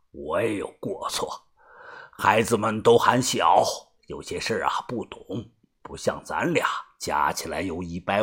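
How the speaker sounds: put-on very low, soft old-man voice; calm elderly leader. Old leader taking some blame before his old friend, calm conciliation. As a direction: placate quietly in a deep, subdued voice.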